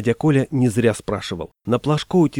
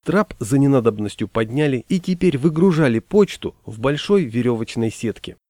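ordinary delivery

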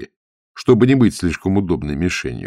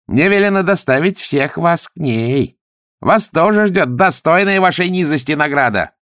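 high, raised, triumphant voice; gloating threat; 10 semitones higher, 4 dB louder than usual. A narrator's reading. Pkhubu Tsitan announces their punishment, gloating. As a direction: lord it over the captives.

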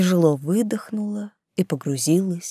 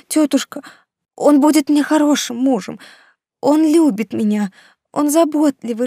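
put-on pleading young woman; 9 semitones higher than usual. Niece pleads with her aunt.

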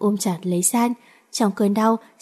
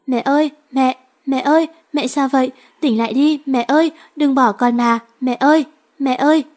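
higher, louder sobbing pleas to mother; crying out for mother; 5 semitones higher, 4 dB louder than usual. A narrator's reading. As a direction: cry out desperately, begging her to wake. Girl cries over dying mother. (gào khóc gọi mẹ, cao và to)

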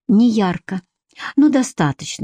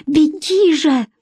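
high, drawn-out urging; higher-pitched, desperate insistence; urgent plea; 8 semitones higher, slower than usual. A narrator's reading. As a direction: beg someone to run. Urging him to flee.

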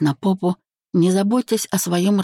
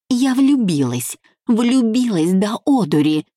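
hysterical shriek; 6 semitones higher than usual. A narrator's reading. Bride confessing love to her friend, high hysterical cry. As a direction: wail it out in a raised pitch. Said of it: "истерично заверещала Марина".

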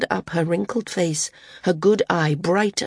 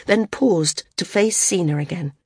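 plain reading voice

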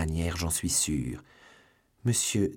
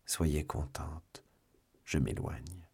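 soft, very slow surrender; resigned farewell; 2 semitones lower, 5 dB quieter, much slower than usual. A narrator's reading. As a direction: give in quietly, letting the words drag. Gives in to her plea, leaving. (résigné, doucement et lentement)

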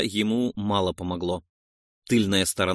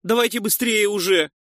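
high, impatient urging cry; shouting; 8 semitones higher, 3 dB louder than usual. A narrator's reading. Noise hurries the men felling the tree, shouting. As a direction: hurry them along with a raised shout. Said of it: "крикнул Нойс".

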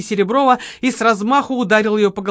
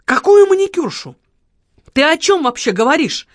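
high, loud bewildered protest; astonished questioning; 8 semitones higher, 5 dB louder than usual. A narrator's reading. Marquis questions Lola's accusation, loudly astonished. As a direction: ask back as if utterly baffled. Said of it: "удивлённо переспросил маркиз".

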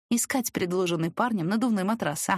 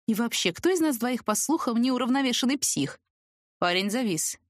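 ordinary delivery

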